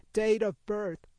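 retold as the healer's embarrassing loud question; loud call. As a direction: call it out for everyone to hear. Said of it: "real loud".

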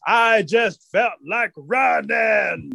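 slow, high, stretched-out call; drawn-out punchline. Acted. Forrest answers Rabbit why he's running, drawn out. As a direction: stretch each word out high.

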